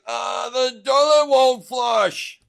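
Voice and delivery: put-on high, slow, loud old-man whine; imitating sheepish grandpa. Grandpa confessing the toilet to his family, high and drawn out. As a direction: stretch it out like a flustered grandpa.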